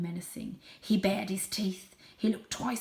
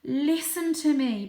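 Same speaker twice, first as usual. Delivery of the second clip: slow, higher pleading; 8 semitones higher, 7 dB louder, slower than usual. Acted. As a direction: beg them to hear. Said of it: "pleaded the elder Paul".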